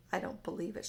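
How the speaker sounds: ordinary delivery, nothing marked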